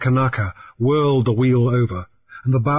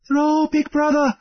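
put-on much higher, slow island girl's plea; Namu pleading. A narrator's reading. Captive Namu urges Grief to throw the dynamite, pleading. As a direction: beg him to throw, slowly. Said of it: "Namu called up in the Fuatino tongue".

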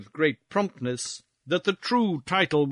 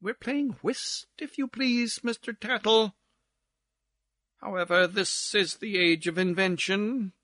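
lightly voiced gossip over whist; lilting society chit-chat; chattering society card player; 7 semitones higher than usual. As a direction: gossip brightly between hands of cards.

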